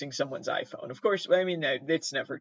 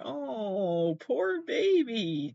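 tender pity; 6 semitones higher, much slower than usual. Real, not acted. Pity for the mummified baby animal.

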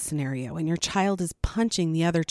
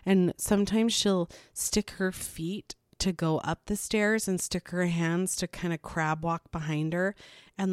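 ordinary talk

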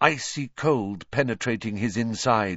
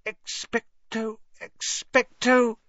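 slow, high, strained spell; faltering attempt; 12 semitones higher, slower than usual. A narrator's reading. Hermione unable to cast the spell, faltering. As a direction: start weakly, then push harder and fail. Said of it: "But she couldn't do it".

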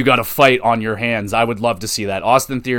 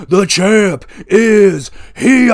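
wrestler's entrance shout, louder, higher and very slow; John Cena impression; 8 semitones higher, 6 dB louder, much slower than usual. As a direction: bellow it like a champion making his entrance. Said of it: "does an excellent John Cena impersonation".

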